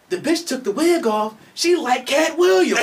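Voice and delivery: quoting the skit. Retold or imitated.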